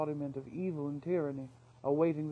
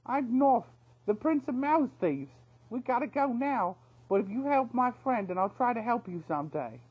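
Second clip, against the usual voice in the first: much higher, louder call over the shoulder; 8 semitones higher, 7 dB louder than usual. Acted. Thief calling back to the caged wildcat, high and loud. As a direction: call back as you hurry off. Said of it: "calling as he went".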